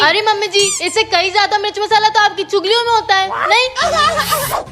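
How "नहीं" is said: high, long drawn-out mock question; taunting. Daughter-in-law jabbing at mother-in-law's gossip, taunting. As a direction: stretch it up high to needle her.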